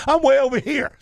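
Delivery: voix haute, riant à travers les mots; laughing. Real, not acted. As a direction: rire en le disant, amusé. Laughing at rescuers going wrong way.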